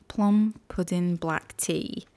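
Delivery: whispered, slow mock British accent. Host resets to read tea notes, whispered British accent. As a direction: breathe it out in a put-on British accent. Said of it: "British accent mindset".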